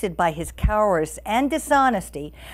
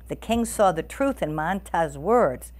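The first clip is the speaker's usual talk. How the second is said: ordinary delivery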